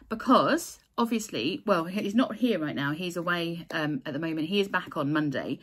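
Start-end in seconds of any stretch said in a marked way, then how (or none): none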